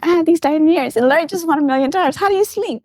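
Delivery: high, quick re-enacted giddiness; giddy excitement. Wife lying awake after gift and big win, giddy. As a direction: gush as if too thrilled to sleep. Too thrilled to sleep, re-enacted.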